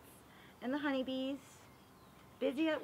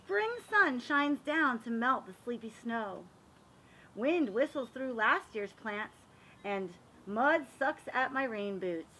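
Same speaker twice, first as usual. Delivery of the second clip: lively, sing-song picture-book reading; reading to children; 5 semitones higher than usual. A narrator's reading. Reading a garden picture book to children, lively storytelling. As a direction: read it like story time for little kids.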